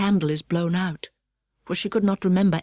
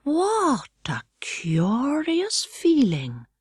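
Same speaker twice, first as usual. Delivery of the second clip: slow, hushed, awed whisper; wondering whisper; 6 semitones higher, much slower than usual. A narrator's reading. Alice feeling herself shrink, slow awed whisper. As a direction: breathe it out slowly in wonder.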